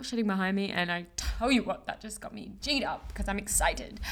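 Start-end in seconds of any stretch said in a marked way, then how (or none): none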